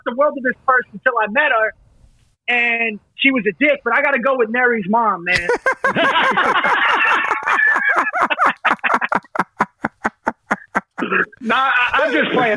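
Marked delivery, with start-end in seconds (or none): 5.5-11.3 long high burst of laughter. Real, not acted. Long high laugh at his own joke about a friend's mom.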